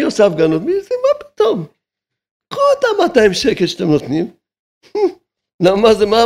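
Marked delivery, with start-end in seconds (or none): 0.6-1.7 high mocking voice. Rabbi scoffing at those arguing over two hundred shekels, high and mocking. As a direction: jump way up into a high, incredulous mocking pitch.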